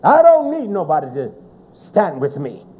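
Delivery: normal talking voice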